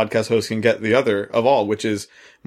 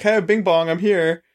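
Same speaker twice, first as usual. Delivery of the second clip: high, playful mock doorbell greeting; 7 semitones higher than usual. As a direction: chirp it like a silly visitor. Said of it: "People aren't like".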